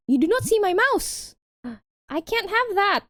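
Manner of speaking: high gasping indignation; mock outrage. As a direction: gasp and protest theatrically.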